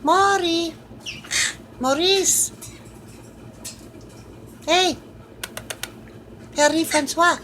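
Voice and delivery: high, sweet voice cooing at a pet. Real, not acted. Owner calling to her parrot, high cooing baby talk.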